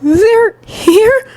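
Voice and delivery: put-on very high, frightened boy's voice; fearful realization. A narrator's reading. Student realizing the villains are here, fearful. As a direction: let the dread squeak through. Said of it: "his eyes wide with fear".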